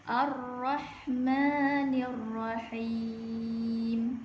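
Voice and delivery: slow, measured tajwid recitation; Quran recitation. Shown as a demonstration. Teacher recites the second verse for students. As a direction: chant it slowly with long held vowels. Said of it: "Sekali lagi".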